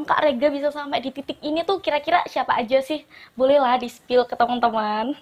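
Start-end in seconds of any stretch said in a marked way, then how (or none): none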